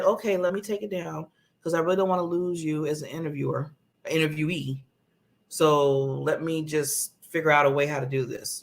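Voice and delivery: low-pitched, apologetic people-pleasing; old, unconfident self. Acted. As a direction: give in meekly, low and subdued. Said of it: "the o-, old [name] would have said".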